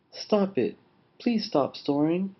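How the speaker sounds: small, high-pitched bat; little voice. A narrator's reading. Scared Bat begs Lion to stop, little voice. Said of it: "heard a little voice".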